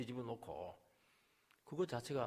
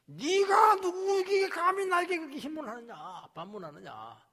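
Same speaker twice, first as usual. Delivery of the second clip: God rebuking; 14 semitones higher, 10 dB louder than usual. Retold or imitated. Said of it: "하나님".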